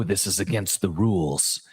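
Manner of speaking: scolding rule enforcer, dropping to a growl; mock yelling in a deep growl; deep growly voice. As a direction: growl it like the obedience police. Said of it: "with that deep growly voice".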